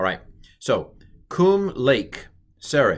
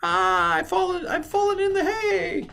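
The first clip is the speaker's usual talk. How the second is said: very high, hammed-up falsetto wail; fallen damsel. Teacher punning on hey with a fake damsel falling in the hay. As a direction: cry out like a swooning damsel in distress. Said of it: "the hey is not a consonantal hey".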